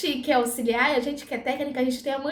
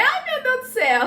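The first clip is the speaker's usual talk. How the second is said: high, loud gasp into laughter; shocked, then cracking up; aghast laugh; 12 semitones higher, 5 dB louder than usual. Retold or imitated. Assistant reacts to the patient's three children, high and laughing.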